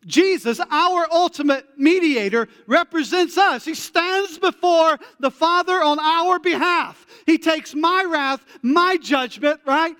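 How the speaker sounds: high, loud, fervent preaching; preaching at full force. Real, not acted. Preacher to congregation, high loud fervent climax. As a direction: lift the room with fervour.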